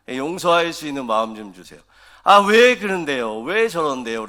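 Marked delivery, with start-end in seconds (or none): 2.2-4.3 loud, higher exasperated outburst; exasperated complaint. Retold or imitated. Believer venting to God about someone, exasperated. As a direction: blurt out your frustration about that person. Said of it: "라고 이야기하셔도 돼요".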